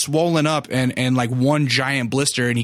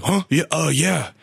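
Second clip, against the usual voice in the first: imitating pained, groaned replies; grunting and groaning; 3 semitones higher than usual. Homeless man answering an offer of help, imitated groaning. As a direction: grunt the words out like a man in pain. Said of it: "almost grunting and groaning, like, his words".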